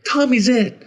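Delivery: put-on high, loud kid shout; gleeful playground call; child exclaiming. A narrator's reading.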